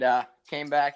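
ordinary talk, nothing marked

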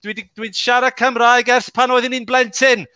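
proud burst of native Welsh; speaking Welsh. Real, not acted. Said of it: "other languages that I speak".

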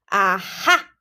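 loud, crowing "aha"; chortling triumph. A narrator's reading. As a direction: pounce on it with a chortle. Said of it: "Fathom chortled".

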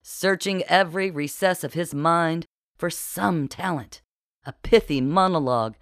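usual speaking voice

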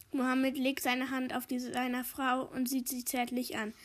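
ordinary delivery, nothing marked

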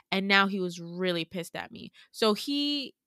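normal talking voice

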